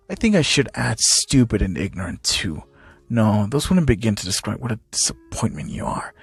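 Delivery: low, soft villain voice; contemptuous Hisashi. Acted. Father insults his disappointing son, contemptuous. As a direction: keep it low and quietly scornful.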